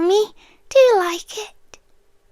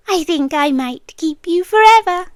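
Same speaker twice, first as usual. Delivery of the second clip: ordinary talk, nothing marked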